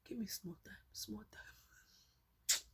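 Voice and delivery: whispered plea, breath only; begging whisper. Retold or imitated. She begs the driver for a moment, whispering. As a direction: beg in a whisper. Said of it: "I said".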